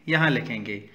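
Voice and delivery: normal talking voice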